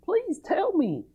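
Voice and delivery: high, slow whiny begging; mock weeping plea. Preacher mimics Samson's wife begging through tears. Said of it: "his wife comes to him crying and weeping and says".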